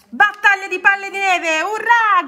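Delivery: high, loud battle cry; gleeful, full-voiced hurrah; jubilant shout. A narrator's reading. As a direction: cheer as a snowball fight starts. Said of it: "gridò Rana".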